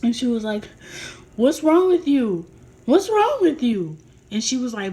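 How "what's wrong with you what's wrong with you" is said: loud, high, drawn-out alarmed questioning; very concerned. Retold or imitated. Sister standing over the narrator on the floor, loud alarm. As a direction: imitate a worried sister close to tears. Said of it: "very, very concerned and was about to cry".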